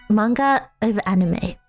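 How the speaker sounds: usual speaking voice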